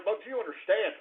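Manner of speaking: usual speaking voice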